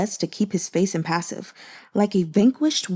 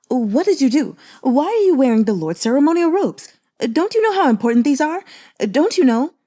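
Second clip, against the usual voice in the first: higher and louder, urgent reproach; alarmed scolding; 5 semitones higher, 5 dB louder than usual. A narrator's reading. Servant scolds Gumang over the ceremonial robes, alarmed and loud. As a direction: scold him in a panic.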